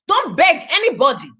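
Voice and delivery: normal talking voice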